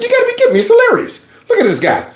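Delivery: very high, laughing protest; hammed-up helpless amusement; acted hilarity. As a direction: squeal it as if cracking up. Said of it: "I'd be like".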